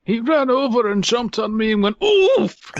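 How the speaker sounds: put-on high, loud old man voice; old-timer voice. Friend joining the old-timer bit, high old voice. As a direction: ham up a feeble grandpa.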